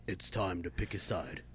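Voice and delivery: low, quiet trailer narrator; hushed dramatic trailer read; movie trailer voice. Pretend. Joining trailer voice parody.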